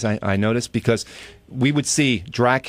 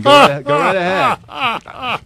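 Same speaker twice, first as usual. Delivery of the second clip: mock slow voice; 10 semitones higher, 4 dB louder, much slower than usual. Host mimics wandering, slow odd voice.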